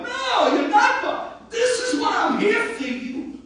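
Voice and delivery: high, loud reassuring cry with a laugh; quoted Chacham. Retold or imitated. Rabbi voicing the Chacham reassuring a congregant, loud and high. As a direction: voice the sage insisting he is there for them. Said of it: "I'm not bothering the rabbi".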